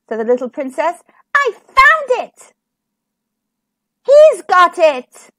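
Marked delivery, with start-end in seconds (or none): 1.3-5.3 high, loud, drawn-out cry; triumphant shout. A narrator's reading. Little princess announcing the missing tooth is found, shouting. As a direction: shout the discovery slowly and high.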